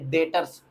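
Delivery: "डेटर्स" low and soft, no B sound; silent B. Shown as a demonstration. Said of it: "बी silent".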